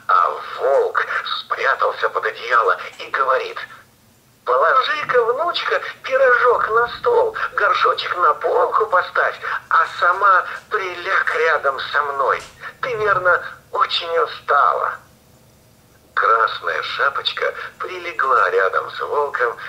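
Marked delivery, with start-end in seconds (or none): 4.5-15.0 fake granny voice. Wolf disguised as granny speaks.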